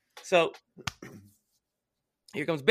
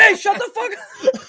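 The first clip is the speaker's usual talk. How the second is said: slow, high, loud yell with a laugh; laughing shout; 7 dB louder, slower than usual. Retold or imitated. Pitcher yelling at the rival coach, laughing shout. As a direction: bark it back with a grin.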